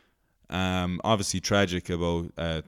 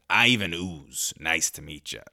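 put-on generic American accent. Villain introduces himself to ancient Greek, generic American accent. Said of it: "generic American accent".

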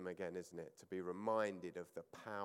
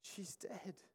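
incredulous scoff; 9 semitones higher, 4 dB quieter than usual. Retold or imitated. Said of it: "but they're like".

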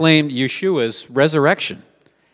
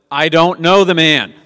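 angry denial; 4 semitones higher, 7 dB louder than usual. Retold or imitated. Peter's third denial, with curses.

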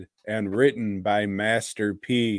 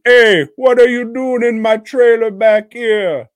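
high, loud put-on character shout; loud mock holler; 11 semitones higher, 10 dB louder than usual. Host acting a movie character at the trailer, loud mock holler. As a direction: call out high and indignant, hammed up.

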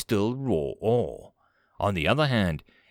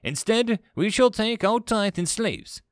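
higher-pitched, deliberate pronouncement; considered announcement; 9 semitones higher than usual. A narrator's reading. Commander settles on slaves as tribute, deliberate. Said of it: "with some consideration".

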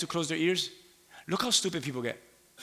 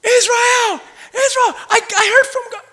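much higher and louder, calling out breathless news; excited shout; 9 dB louder than usual. Retold or imitated. Moses running down the mountain, calling to Israel high and loud. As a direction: Moses runs down bursting with his news. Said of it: "and he's running down".

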